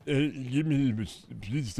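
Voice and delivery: halting, fumbling parody of a politician; Trump. Acted. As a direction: stumble through it, lost for words. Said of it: "we gotta do, like, modern Trump".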